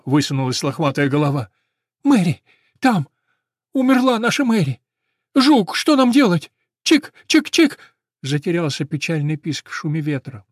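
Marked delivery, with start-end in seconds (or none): 2.0-7.8 high, loud frantic chirping; panicked cry. Acted. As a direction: cry out the terrible news. Sparrow announces Mary's death.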